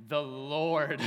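a hammed-up proclamation of The Lord; booming. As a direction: boom The Lord like a herald. Repeating it louder, mock reverent.

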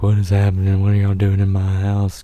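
mock freak-out, pitch up. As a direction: raise the voice in confused panic. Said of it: "I'm freaking out".

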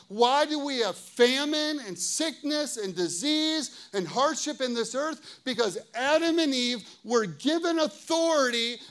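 loud, forceful sermon build; impassioned preaching. Real, not acted. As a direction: proclaim it with driving emphasis, phrase after phrase.

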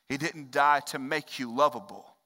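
ordinary delivery

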